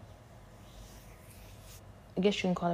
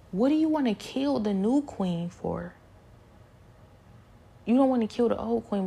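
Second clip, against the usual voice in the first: indignant; 4 semitones higher, 6 dB louder than usual. Retold or imitated. Protesting against being killed.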